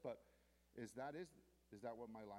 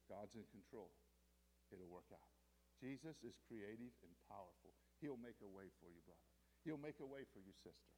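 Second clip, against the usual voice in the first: low, soft reassurance; gentle, settled encouragement; calm exhortation; 6 semitones lower, 5 dB quieter than usual. Acted. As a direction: comfort a brother quietly. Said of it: "exhorting one another".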